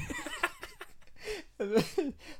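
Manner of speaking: squeaky laugh breaking out; can't hold the laugh in, high and bright; high burst of laughter. Real, not acted. Laughing at a silly listener question, high burst of laughter.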